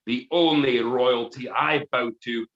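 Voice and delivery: building to a high, loud roar; defiant Gordon roars at the Duke, building loud; roaring defiance. Acted. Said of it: "he roared in anger".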